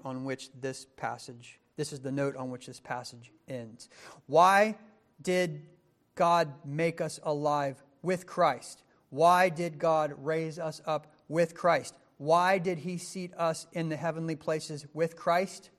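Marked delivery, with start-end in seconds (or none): 4.3-15.7 slower, louder rhetorical questions; preaching at full force. Acted. Preacher asking the congregation why God raised them, full force. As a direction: press each why on the listeners.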